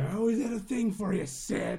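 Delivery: high, loud leering line; creepy Stu voice. Retold or imitated. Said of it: "he's like".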